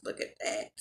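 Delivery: breathy whisper of awe. Real, not acted. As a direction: hush it out in wonder. Marvelling at the finished food.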